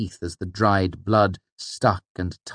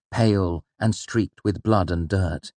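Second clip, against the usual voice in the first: usual speaking voice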